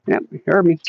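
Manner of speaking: ordinary delivery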